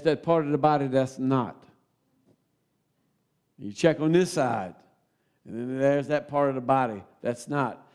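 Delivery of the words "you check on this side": usual speaking voice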